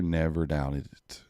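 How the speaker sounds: slow and low, savouring each word; slow smug repeat. Real, not acted. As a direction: lean back and drawl it, satisfied. Repeating the cheer, drawn out.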